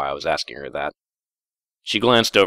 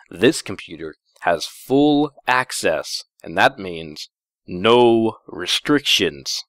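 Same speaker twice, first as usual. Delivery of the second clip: meaningful slow hint; 3 semitones higher, much slower than usual. Acted. Heavy-handed hint to Twilight.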